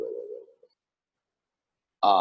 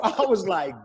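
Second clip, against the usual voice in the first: ordinary talk, nothing marked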